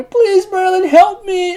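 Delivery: squeaky, drawn-out mock cry; mock scream for help. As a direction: imitate a tiny creature squealing for help. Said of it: "he ends up screaming".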